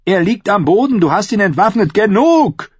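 urgent high, loud yell; shouting. A narrator's reading. Halder stopping John's attack on Emmet, shouting. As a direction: shout him off a beaten man. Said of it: "rief Halder".

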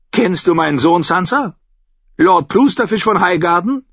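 high, loud voice of a mocking old noblewoman; a sly, tart old lady holding court; Olenna Tyrell. A narrator's reading. Olenna mocking a rival to Sansa, high and loud.

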